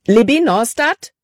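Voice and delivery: hoher, ängstlicher Ruf nach ihr; panisch. Acted. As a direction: ruft besorgt ihren Namen. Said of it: "Calebs Stimme war panikerfüllt". (Caleb ruft Libby panisch, hoch und ängstlich)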